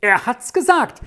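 put-on high-pitched, agitated boy's outpouring; screeching boy. A narrator's reading. Boy fearing father's beating tonight, agitated high screech. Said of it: "kreischte der eine".

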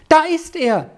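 high-pitched, delighted exclamation; joyful cry. A narrator's reading. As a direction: cry it out for joy. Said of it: "schrie der Sami und sprang vom Boden auf vor Freude". (freudiger, hoher Ausruf)

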